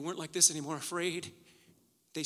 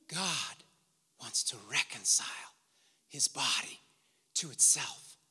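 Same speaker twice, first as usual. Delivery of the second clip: hushed solemn preaching; 7 semitones lower than usual. Real, not acted. Preacher urging the church to reconcile, hushed and solemn. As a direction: let each phrase fall into a hush. Sermon drops to solemn hush.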